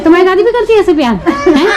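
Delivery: laughing question. Real, not acted. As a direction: ask a little one, laughing and pitched right up. Laughing, asking child about grandma.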